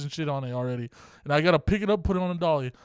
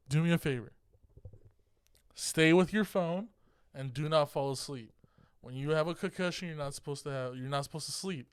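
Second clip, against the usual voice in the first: hushed, much softer voice; retold girlfriend, speaking softly; soft earnest request; 2 semitones lower, 9 dB quieter than usual. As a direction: gently lay down a careful instruction. Girlfriend asks him a favor.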